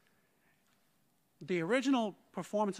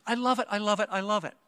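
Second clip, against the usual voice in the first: excited gushing; 3 semitones higher, 8 dB louder, faster than usual. Retold or imitated. Colleague thrilled by the score she was given, excited. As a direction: burst out with it, thrilled and loud. Retelling her thrilled outburst.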